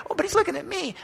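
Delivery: very high, thrilled protest of wonder; amazed saint. Acted. A third saint insisting Jesus looks at them, very high and thrilled. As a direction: insist delightedly it's you he sees. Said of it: "And over here".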